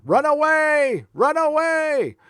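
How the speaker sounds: high, slow fleeing knight cry; silly high-pitched retreat cry; mock Monty Python cry. Monty Python quote after runaway joke.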